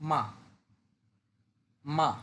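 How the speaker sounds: quick falling মা; Mandarin fourth tone. Shown as a demonstration. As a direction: drop মা fast and cut it short. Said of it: "শব্দটা দ্রুত বলতে হয়, দ্রুত বলে শেষ করে দিতে হয়".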